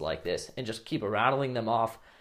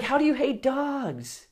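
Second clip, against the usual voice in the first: put-on shocked girl; 14 semitones higher, 3 dB louder, slower than usual.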